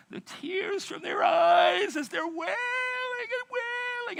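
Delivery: high, slow wailing cry of mourners; mock wailing. Preacher mimicking mourners wailing on the stone, mock wailing. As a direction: wail it out like a grieving woman.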